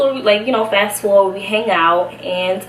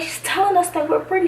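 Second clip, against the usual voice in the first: urgent aside; 10 semitones higher than usual. Retold or imitated. Explaining to Natalie.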